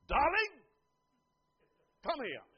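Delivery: loud, high, abrupt call of an endearment; curt mock summons of a spouse; loveless summons. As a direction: call it out like an order, no tenderness. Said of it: "Same word, no feeling".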